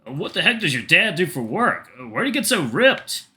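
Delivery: a hint of an incredulous boyfriend voice. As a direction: play the boyfriend, taken aback.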